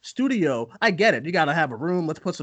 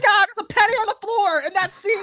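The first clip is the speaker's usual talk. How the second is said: high, mocking fan voice; shrill-free but pitched-up mock gasp; mock nitpicker; 4 dB louder, faster than usual. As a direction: parody an obsessive fan spotting a flaw.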